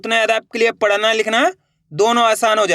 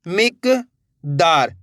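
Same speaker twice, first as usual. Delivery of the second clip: slow drawn-out word; 3 semitones lower, much slower than usual. Shown as a demonstration. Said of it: "ये का आ गया।".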